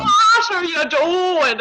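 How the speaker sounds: high, drawn-out mock-Irish holler; mocking Irish accent. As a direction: ham up a slow, teasing Irish accent. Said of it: "We don't sound like that".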